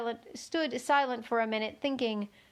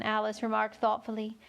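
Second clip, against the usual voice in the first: usual speaking voice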